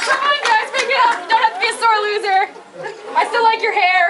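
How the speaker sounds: loud taunt. Real, not acted. Taunting the other team after winning, loud gloat. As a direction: gloat at them loudly.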